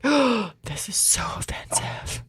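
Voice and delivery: slow scandalized whisper; hushed, pearl-clutching prude; shocked whisper. Retold or imitated. Mocking her younger self's shock, slow whisper. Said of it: "we were just like".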